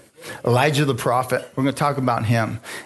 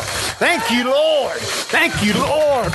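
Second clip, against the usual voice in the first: fervent thanks; 10 semitones higher, much slower than usual. Acted. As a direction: Lord cried out high. Preacher crying out praise.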